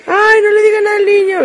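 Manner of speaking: very high-pitched put-on wail of protest; protesting relative. A relative telling everyone to leave the boy alone, high mock protest. Imitates relative defending the child.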